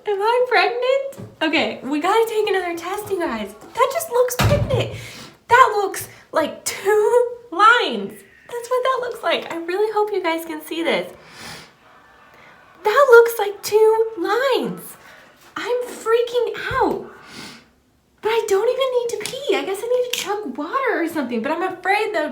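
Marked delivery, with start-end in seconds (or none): 0.1-1.3 surprised. Real, not acted. Anxious hopeful test check. 1.4-19.6 excited. Real, not acted. Excited pregnancy test reveal.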